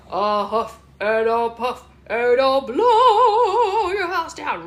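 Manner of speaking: loud, drawn-out huff swelling and swooping up; big bad wolf. Mother plays the wolf coming to the straw house, playful menace. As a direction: stretch out the storybook wolf's threat. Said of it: "the wolf comes and says".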